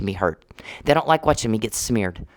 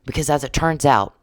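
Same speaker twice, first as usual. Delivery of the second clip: usual speaking voice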